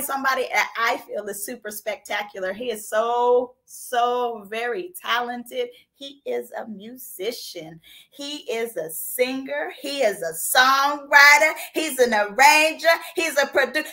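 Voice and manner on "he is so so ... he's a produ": slow, spaced-out praise building louder. Real, not acted. Host introducing her guest's talents, slow and building. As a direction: savor each title like a hype reel.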